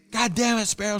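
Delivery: high-pitched mock yell, kept soft; imitated yell. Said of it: "she's yelling".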